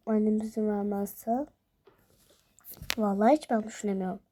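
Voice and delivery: usual speaking voice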